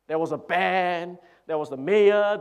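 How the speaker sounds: usual speaking voice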